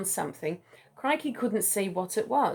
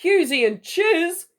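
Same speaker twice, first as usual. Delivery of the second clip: higher, louder, slow disbelieving echo of Husey and Chiz; incredulous repeat; 9 semitones higher, 8 dB louder than usual. Acted. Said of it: "Sheila repeated".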